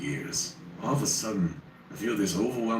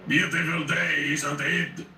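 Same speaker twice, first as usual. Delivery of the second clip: put-on booming French accent; 9 semitones higher, slower than usual. A narrator's reading. Friendly giant greeting the narrator in a booming French accent. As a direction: boom it out in a cheerful French accent. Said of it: "booming French accented voice".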